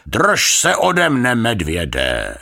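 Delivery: loud, high theatrical warning; slow melodramatic cry, falling at the end; dramatic. A narrator's reading. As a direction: ward him off like a stage actor, drawn out. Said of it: "řekl dramaticky".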